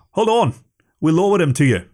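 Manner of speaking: high hailing call across the water; captain calling across the water to the agent, high call. Acted. Captain calls across to cutter.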